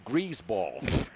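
low, quiet "grease balls" with a z; "grease balls" said as "greazeballs", deadpan and low; Pesci-style insult. Retold or imitated. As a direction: drop "grease balls" as a low verdict, soft z. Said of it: "that's the way it should be used".

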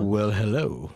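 low, smooth seductive greeting. Pretend. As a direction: purr it low and suggestive. Flirty reaction to the line.

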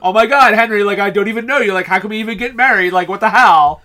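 loud, bubbly mock valley girl; valley girl Catherine. Podcast host mocks the princess as a Valley Girl, loud and bubbly. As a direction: ham up a ditzy teen gushing about Henry.

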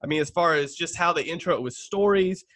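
ordinary delivery

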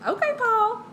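high sassy teasing call-out; sassy okay. Retold or imitated. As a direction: tease Paul in a high sassy voice. Teasing Paul's sass.

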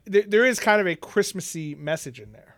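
ordinary talk, nothing marked

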